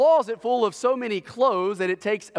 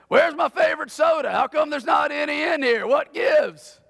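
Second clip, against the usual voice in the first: higher, louder demanding yell of a spoiled son; mock demanding shout; 6 semitones higher, 4 dB louder than usual. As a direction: shout it like a kid who expects service. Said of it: "he said".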